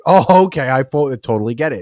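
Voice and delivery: suddenly getting it, amused; mock realization. Son reacting in retold story.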